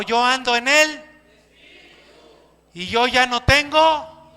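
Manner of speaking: loud, fervent preaching; declaiming scripture with fire; preaching at full force. Acted. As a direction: proclaim it to the congregation.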